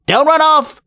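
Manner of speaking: high-pitched call after a fleeing man; shouted. Acted. Nobby shouting after the man not to run off. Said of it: "he shouted".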